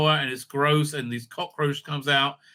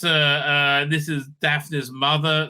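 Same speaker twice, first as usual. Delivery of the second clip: usual speaking voice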